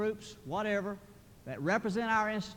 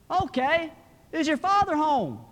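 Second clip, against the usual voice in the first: high, loud phone voice; loud telemarketer; 9 semitones higher, 9 dB louder than usual. Acted. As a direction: quiz a child loudly down the line. Telemarketer calling a whispering child.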